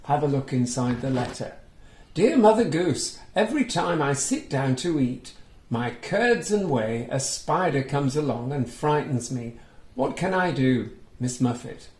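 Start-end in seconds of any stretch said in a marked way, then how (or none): 2.1-11.9 put-on reading to children. A narrator's reading. Reading a picture book aloud to young children, lively storytelling. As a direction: bring the story alive for little listeners. Reads Miss Muffet's letter.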